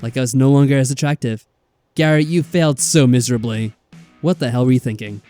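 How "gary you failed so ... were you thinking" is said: normal talking voice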